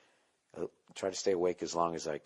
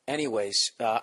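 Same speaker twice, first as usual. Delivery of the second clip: ordinary delivery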